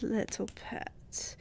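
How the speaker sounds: slow, whispered; tender mocking whisper; soft whispered pet name. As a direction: breathe it slowly. Vampire soothes hunter.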